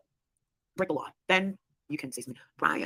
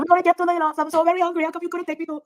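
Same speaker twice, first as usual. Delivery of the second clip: high-pitched imitation of a Spanish-accented lady; 9 semitones higher, 5 dB louder than usual. Re-enacting her car-ride bit for her friend, high mock Spanish accent. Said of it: "imitating that Spanish accent of that lady".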